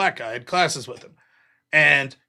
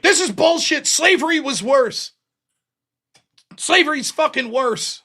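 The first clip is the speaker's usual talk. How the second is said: indignant outburst; 8 semitones higher than usual. Retold or imitated. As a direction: storm up and object in outrage. John angrily objects in class.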